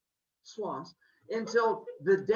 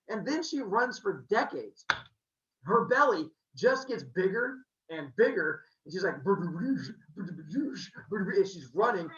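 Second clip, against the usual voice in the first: ordinary talk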